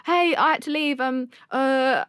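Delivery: playing it cool after the chaos; breezy text voice. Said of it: "And I was like".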